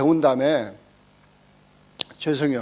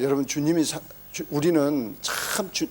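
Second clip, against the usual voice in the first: ordinary talk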